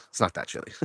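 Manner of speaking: usual speaking voice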